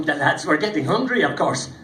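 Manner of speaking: plain reading voice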